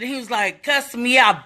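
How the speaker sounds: usual speaking voice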